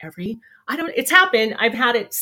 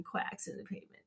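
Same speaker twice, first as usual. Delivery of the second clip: whispered to herself; 18 dB quieter than usual. Real, not acted. Host repeating the punchline softly to herself, whispering. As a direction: breathe quacks in the pavement as a private whisper.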